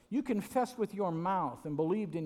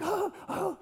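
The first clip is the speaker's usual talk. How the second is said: gasping for breath; 11 semitones higher than usual. Acted. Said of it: "getting his breath".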